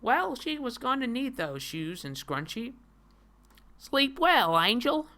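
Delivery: lightly acted, high-pitched comic line; put-on character voice.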